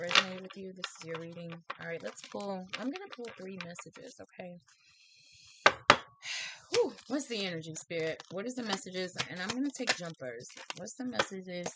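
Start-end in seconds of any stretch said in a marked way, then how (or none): 5.5-6.6 loud sigh. Real, not acted. Big sigh before pulling cards.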